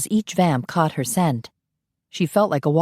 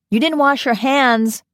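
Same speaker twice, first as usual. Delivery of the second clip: higher, louder, called across the room; calling after them; 9 semitones higher, 4 dB louder, slower than usual. A narrator's reading. Tony calls after the vamps leaving the restroom, loud and high. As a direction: fling it after the departing women. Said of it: "Tony called after them".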